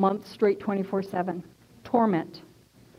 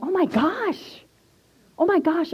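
retold shock, pitched way up; 7 semitones higher than usual. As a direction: act out stunned disbelief. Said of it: "I was so shocked".

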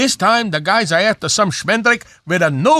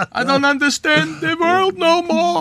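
put-on old man lament; 8 semitones higher than usual.

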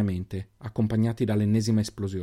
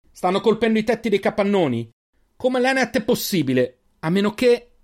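high, loud alarmed calling; urgent yell under fire; urgent shout; 10 semitones higher, 4 dB louder than usual. A narrator's reading. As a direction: shout over the blasts.

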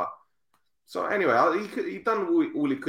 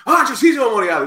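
excited recognition; 10 semitones higher, 10 dB louder than usual. Retold or imitated. Passport officer voiced in story.

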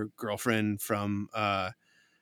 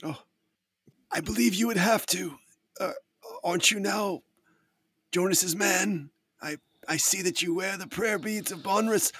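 high, lightly voiced character; 8 semitones higher than usual. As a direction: play a polite, eager NPC. GM voices NPC.

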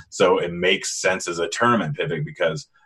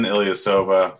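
ordinary delivery

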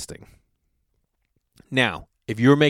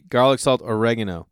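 ordinary talk

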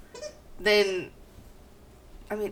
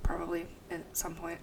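whispered aside; 2 semitones lower, 9 dB quieter, faster than usual. Real, not acted.

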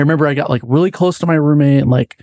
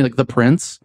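ordinary talk

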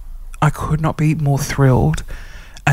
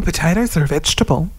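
ordinary talk

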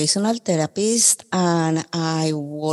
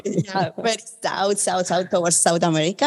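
laughing. Real, not acted. Joking answer, laughing.

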